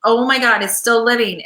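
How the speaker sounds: normal talking voice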